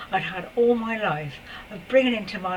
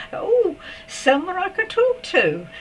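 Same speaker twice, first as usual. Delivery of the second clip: delighted; 11 semitones higher, 5 dB louder, slower than usual. Real, not acted. Delight at finding company.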